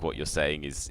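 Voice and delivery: ordinary delivery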